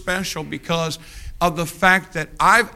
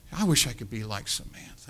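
wistful sigh; 5 semitones lower, 9 dB quieter than usual. Retold or imitated. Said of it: "She sighs".

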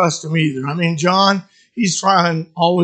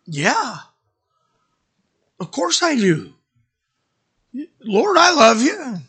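earnest reassurance; 6 semitones higher than usual. Acted. Peter answers Jesus's question.

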